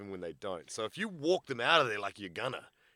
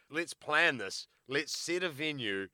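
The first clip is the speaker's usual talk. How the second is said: mock formal challenge; 4 semitones higher, 6 dB louder than usual. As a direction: set the fight like a promoter. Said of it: "For somebody to say".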